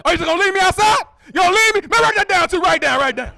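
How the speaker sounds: high, loud, overeager yelling; excited friend. Retold or imitated. Friend insisting the comic write it down, hyped. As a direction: shout it like a hyped buddy. Friend left outside car.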